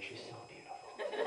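hushed, high, whispery awe; awestruck. Retold or imitated. Smitten man on the floor gazing at the cop, awestruck whisper. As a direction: whisper it as if dazzled, half laughing.

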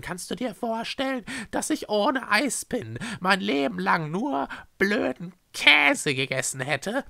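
high, swooping character voice; gushing Schreckse. Acted. Schreckse raves to Echo about new foods, rapturous. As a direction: rave rapturously, stretching the favourite words.

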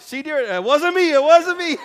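high, laughing protest to his wife; laughing tease. Retold or imitated.

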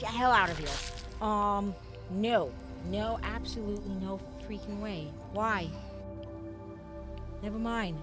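normal talking voice

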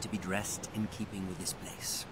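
hushed whispered instruction; secretive whisper while casting. Acted. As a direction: drop to a whisper mid-sentence. Howl murmurs while disguising them.

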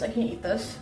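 ordinary talk

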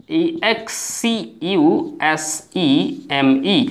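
letters spelt one by one, very slow; slow teacher spelling out letters; spelling out slowly. Shown as a demonstration. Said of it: "উচ্চারণটা খেয়াল করবেন।".

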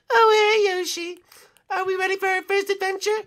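very high cartoon creature voice; squeaky and fast, full of cheer; high Birdo voice.

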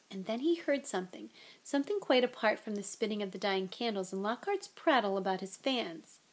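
ordinary delivery

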